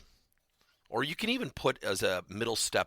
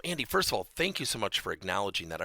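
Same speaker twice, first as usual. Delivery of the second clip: ordinary talk, nothing marked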